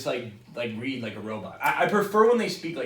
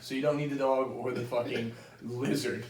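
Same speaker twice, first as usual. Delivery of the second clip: usual speaking voice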